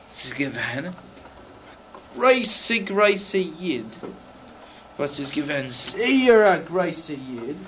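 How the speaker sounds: usual speaking voice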